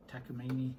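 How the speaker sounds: Takamine said "TAK-uh-MEE-nee"; alternative pronunciation. Shown as a demonstration. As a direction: end Takamine in "-meeny", final e sounded. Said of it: "I don't know how to pronounce it".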